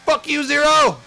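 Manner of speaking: high, loud yelled curse; shouted insult. Pretend. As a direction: scream it at the rival. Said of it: "He's like".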